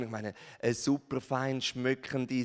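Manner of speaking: ordinary delivery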